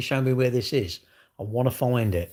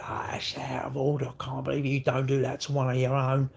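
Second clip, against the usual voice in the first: a quiet, put-on voice of an indignant old local; mock outraged local; 4 dB quieter than usual. Ranting vlogger mimics a neighbour upset about crime, quiet and indignant. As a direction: act out a stunned neighbour tutting quietly. Voicing a shocked mugging victim.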